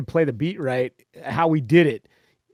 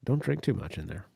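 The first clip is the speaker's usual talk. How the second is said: softer, lower wifely warning; mock wife warning; 8 semitones lower, 10 dB quieter than usual. Wife warning husband before the podcast, softer lightly voiced warning. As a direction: warn him quietly like a wife. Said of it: "she looks at me and she's like".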